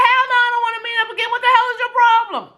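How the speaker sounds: high-pitched, fast sassy refusal; indignant refusal. Retold or imitated. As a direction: fire back a sharp, quick no. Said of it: "I was like".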